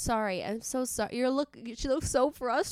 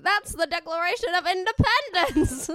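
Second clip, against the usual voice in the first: put-on raised, loud old man quoting history; 9 semitones higher, 7 dB louder than usual. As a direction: play a proud old man. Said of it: "Can I be the old man that's like".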